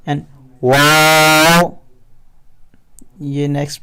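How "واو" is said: واو drawn out very slowly and louder; recited waw. Shown as a demonstration. Teacher reciting letters for students. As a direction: hold واو long. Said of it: "یہ ہم پڑھیں گے".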